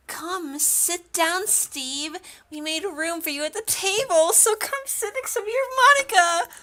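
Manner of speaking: put-on high-pitched, inviting girl character; high cartoonish welcoming voice; eager game girl.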